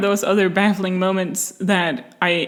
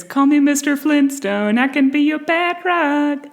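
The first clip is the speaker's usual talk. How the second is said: high mock Flintstone line; jokey higher voice, playful; silly imagined line; 7 semitones higher than usual. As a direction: ham up a goofy pickup line, pitched high. Mock Hannibal line joke.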